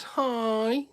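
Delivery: very high squeak; mock squeaky greeting. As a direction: pop out a tiny high hi. Squeaky interjection.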